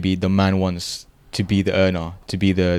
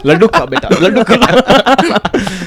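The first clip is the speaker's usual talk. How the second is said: high, loud elder voice through laughter; hammed-up elder pressing sweets on a child, laughing; mock doting elder; 13 semitones higher, 8 dB louder, much slower than usual. Elder urging a child to eat laddu, hammed-up and laughing.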